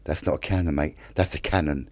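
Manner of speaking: low, soft, deadpan retort; deadpan correction. Retold or imitated. Skipper correcting short-sighted Neville, low mock deadpan. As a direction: drop the voice to correct him drily.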